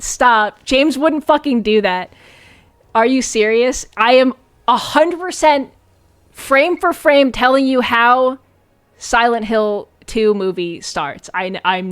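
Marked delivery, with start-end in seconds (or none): none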